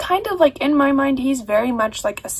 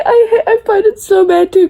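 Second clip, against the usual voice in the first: crying; 7 semitones higher, 6 dB louder than usual. Real, not acted. Grieving a killed character.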